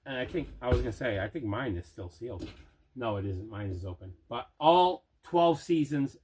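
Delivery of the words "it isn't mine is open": normal talking voice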